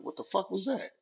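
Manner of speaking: high startled exclamation. Retold or imitated. Teen startled by a squirt, high startled cry. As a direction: react like something just whizzed past.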